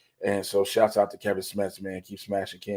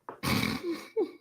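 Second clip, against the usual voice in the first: high laugh; 7 dB quieter than usual. Real, not acted. Laugh alone on line.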